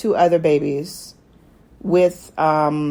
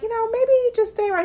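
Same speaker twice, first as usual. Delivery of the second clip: coaxing plea; faster than usual. Retold or imitated. Council coaxing the king.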